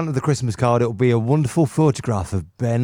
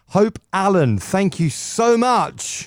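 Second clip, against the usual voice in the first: Wogan-style thanks; 6 semitones higher, slower than usual. Pretend. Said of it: "Don't you start".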